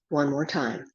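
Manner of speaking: ordinary talk, nothing marked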